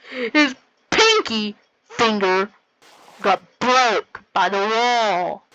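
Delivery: slow, drawn-out mock-epic narration; movie slow-mo voice, hammed up; slow-motion voice. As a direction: stretch each word like a slow-motion replay.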